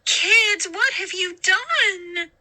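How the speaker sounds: high, distressed mother's voice; the shocked mother crying out to the kids. A narrator's reading. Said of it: "Missus Brewer cried".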